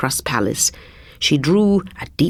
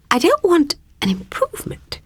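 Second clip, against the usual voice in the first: princess voice; 13 semitones higher than usual. Acted. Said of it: "she said".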